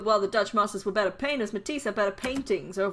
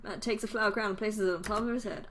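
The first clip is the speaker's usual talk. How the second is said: ordinary delivery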